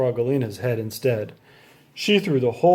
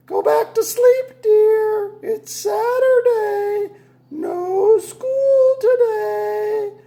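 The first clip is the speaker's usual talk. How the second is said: high, slow, sing-song mother voice; drawn-out high mother voice; mother's voice; 4 dB louder, much slower than usual. A narrator's reading. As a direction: coax him gently back to sleep. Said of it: "his mother".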